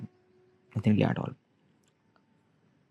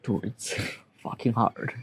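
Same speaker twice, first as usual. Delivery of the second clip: real crying. Real, not acted. Man admitting it's hard, crying as he denies it. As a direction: fight back tears while talking. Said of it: "why am I crying".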